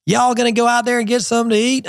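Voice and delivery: imitated US Southern regional accent. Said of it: "I can follow the accent as it migrates south".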